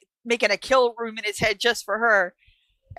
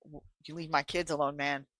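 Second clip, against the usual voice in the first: low, quick, understated threat; retold quiet warning; 6 semitones lower, 7 dB quieter than usual. Dexter warning a man in the grocery store, quietly menacing. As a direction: imitate a father quietly warning someone off.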